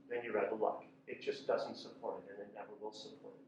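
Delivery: usual speaking voice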